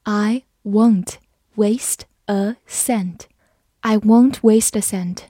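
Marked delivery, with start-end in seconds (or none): 0.0-3.2 slow, careful reading for learners. Shown as a demonstration. Teacher reads slowly for the class. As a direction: read it slowly, word by word. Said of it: "Waste就是浪费的意思。".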